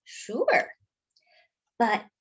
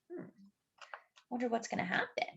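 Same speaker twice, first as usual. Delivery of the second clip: much softer, hushed musing; hushed aside; 12 dB quieter, much faster than usual. A narrator's reading. Commentator voice-over wondering what comes next, hushed. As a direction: murmur it like a secret.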